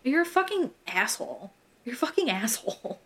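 ordinary delivery

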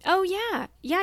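sudden high oh of recognition; realizing something all at once; surprised. Real, not acted. Sudden recall of actor's film.

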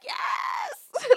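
a very high, squealing cry of delight; excited yes. Retold or imitated. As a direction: shriek it out as the beat drops. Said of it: "I was sitting there like".

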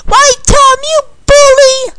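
very high, loud accusation; outraged cry. Acted. Candy to Tom who pushed her, outraged cry. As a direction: shriek it at the one who pushed you.

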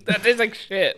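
laughing through the complaint; half-laughing, grossed out; laughing in disgust. Real, not acted.

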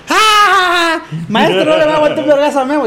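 high excited yelp, then a mocking laugh; imitated excited egging on. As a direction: whoop it up like a friend cheering a fight. Friend Kelvin eggs on the fight.

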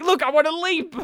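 mock urgency, itching to pounce; eager lizard man. Host voices actor's eyes, high mock-eager voice. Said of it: "see how he's like".

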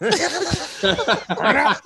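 high shout through laughter; laughing shout. Retold or imitated. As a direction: crack up while yelling it. Repeats quote laughing.

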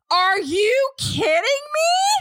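high, stretched disbelieving cry; shocked outcry. Real, not acted. Shocked friend reacts to steam bowl.

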